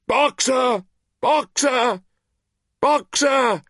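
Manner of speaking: high, loud called name; desperate cry. Acted. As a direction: cry his name after the van. Clover chasing the van.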